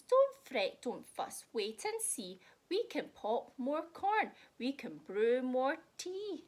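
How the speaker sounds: a lightly voiced squeaky mouse; lightly squeaky cheerful mouse. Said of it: "Mouse squeaks".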